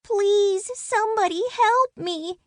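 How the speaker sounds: high tiny voice begging; a trapped little one pleading; small voice pleading. Acted. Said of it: "a small voice".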